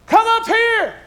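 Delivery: Lord's call, high and loud; shout. A narrator's reading. The Lord calls believers up to heaven, ringing shout. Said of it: "we'll hear Him shout".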